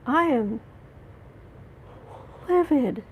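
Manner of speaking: livid. Real, not acted. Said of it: "I'm trying to hold it together".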